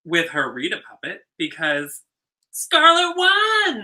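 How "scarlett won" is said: high, slow, excited reveal; excited announcement. Real, not acted. Telling her co-host who won, excited and high. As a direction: belt out the winner with glee.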